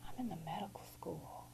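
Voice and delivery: hushed, stricken inner voice; whispered thought. Retold or imitated. Collapsed on the floor, she whispers her self-blame. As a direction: whisper it to yourself in shock.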